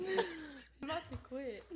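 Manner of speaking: slow, high, laughing mock threat. Real, not acted. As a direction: threaten to quit through giggles.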